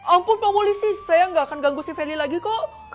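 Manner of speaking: high, loud panicked plea; frightened begging. Acted. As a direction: beg for mercy in a panic. Villain begs police for mercy. (memohon ampun dengan panik, suara tinggi)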